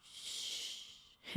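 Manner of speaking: long, soft hush; soothing shush. Acted. Vampire comforting her pet on her shoulder, soothing shush. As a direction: hush someone who is crying, softly.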